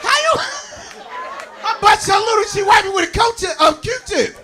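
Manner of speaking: stare in mock horror at her; mock disbelief. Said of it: "I'm like".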